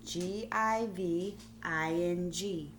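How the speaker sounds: letters spelled out slowly; careful slow spelling for children. Shown as a demonstration.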